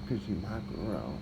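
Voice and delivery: ordinary talk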